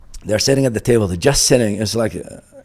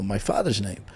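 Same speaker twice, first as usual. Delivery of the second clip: ordinary delivery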